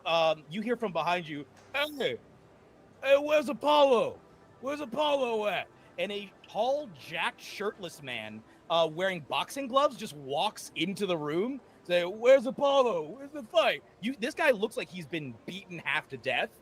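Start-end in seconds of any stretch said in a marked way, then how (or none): 1.7-5.7 lightly voiced boxer. 12.0-13.8 lightly voiced boxer. Boxer keeps asking.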